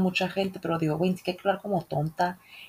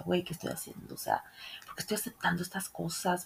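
whispered self-doubt; 11 dB quieter than usual. Retold or imitated. Confused inner thoughts, quoted.

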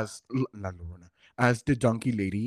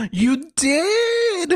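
high excited you did; real surprise, high and bright; surprised; 4 dB louder than usual. Real, not acted. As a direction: squeal with delight. Hears friend went flying.

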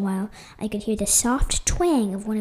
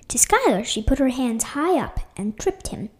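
usual speaking voice

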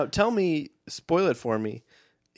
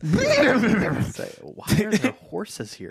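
confused voice; 9 semitones higher, much slower than usual. Retold or imitated. Mock confused character voice.

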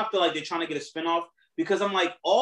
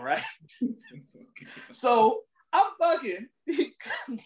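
normal talking voice

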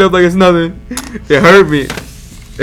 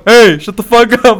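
higher, mock shout from a window; man calling out; 5 semitones higher, 4 dB louder than usual. Retelling a neighbor at the window, mock shout. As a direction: holler it like an annoyed neighbor. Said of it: "I hear a guy go".

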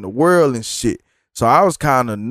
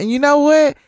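imitating the fan lady; 14 semitones higher, 5 dB louder than usual. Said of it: "And so she say".

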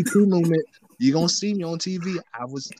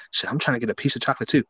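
normal talking voice

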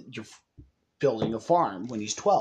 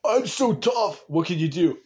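hammed-up, louder tough-guy mockery; mock a guy pretending to be tough; fake tough guy; 3 semitones higher, 3 dB louder than usual. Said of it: "people who pretend to be tough".

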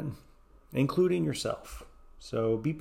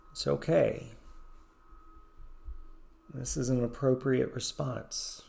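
soothing guided meditation voice. Acted. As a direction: ease the listener through each breath.

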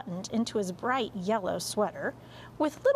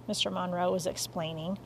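ordinary delivery

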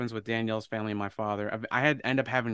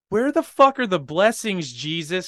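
high, loud shout breaking into laughter; mock outraged demand; 8 semitones higher, 11 dB louder than usual. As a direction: holler a sarcastic complaint to heaven. Sarcastic closing demand to Jesus.

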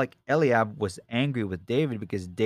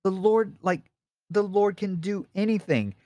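confident joy; 10 semitones higher than usual. Retold or imitated. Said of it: "his faith was already like".

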